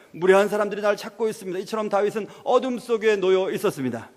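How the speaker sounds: normal talking voice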